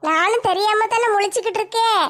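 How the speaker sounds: ordinary talk, nothing marked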